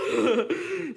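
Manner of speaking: high, loud burst of laughter. Real, not acted. Laughing at the memory.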